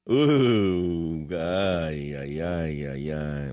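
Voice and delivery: drawn-out "ooh, ay, ay", sinking; falling, fading groan; long groaning sigh. Real, not acted. Sighing before reading next headline.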